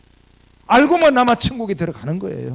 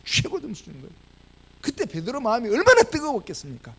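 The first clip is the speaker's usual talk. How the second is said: ordinary delivery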